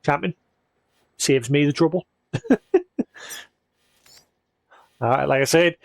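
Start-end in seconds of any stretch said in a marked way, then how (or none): 2.3-3.0 high laugh. Real, not acted. Laughs at someone fixing roads.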